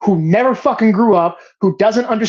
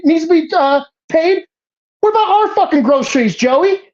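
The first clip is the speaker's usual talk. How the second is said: heated rant; 7 semitones higher than usual. Real, not acted. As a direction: vent the outrage, building steam. Political rant, voice rising.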